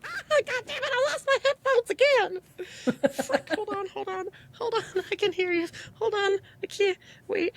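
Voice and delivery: high, flustered scramble; frantic fumbling with headphones. Real, not acted.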